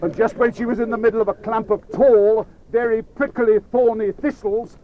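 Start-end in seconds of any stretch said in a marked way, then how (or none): none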